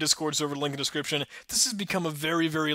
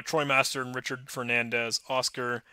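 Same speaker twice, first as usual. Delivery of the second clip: ordinary delivery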